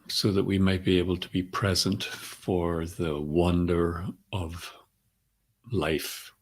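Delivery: calm centering voice with long pauses between phrases; guided meditation. Acted. Teacher centering the group before class, calm guided meditation. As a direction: guide them gently into the breath, unhurried.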